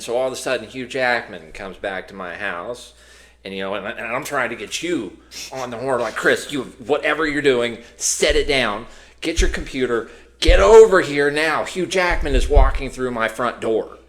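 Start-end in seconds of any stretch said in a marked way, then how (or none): 6.2-14.0 loud, frantic phone summons; urgent excited shout. Acted. Calling his co-host about the star, urgent and shouted. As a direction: raise your voice, building to a higher command.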